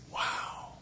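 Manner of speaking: barely voiced gasp of wonder; whispered awe. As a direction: let it out under your breath, awestruck. Worshiper marvels at glistening bull.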